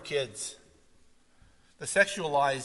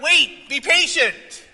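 much higher, loud command; loud urging; 10 semitones higher, 11 dB louder, slower than usual. Acted. Preacher urging the congregation, loud command. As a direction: call them to wait.

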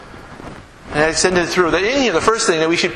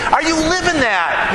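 ordinary talk

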